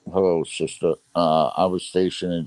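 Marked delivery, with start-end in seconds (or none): none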